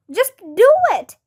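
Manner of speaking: impatient shout, no time to argue. A narrator's reading. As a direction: order her to hurry. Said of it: "shouted Andrea".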